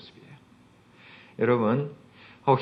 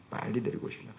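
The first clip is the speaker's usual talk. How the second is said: whispered, stress on 빨리; whispered plea; 11 dB quieter, faster than usual. Shown as a demonstration. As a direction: breathe 빨리 데리고 오십시오 as a hushed urging. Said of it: "repeated plea whispered".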